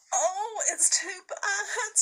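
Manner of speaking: high, tearful sobbing voice. A narrator's reading. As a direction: sob the words out. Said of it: "sobbed Lucy".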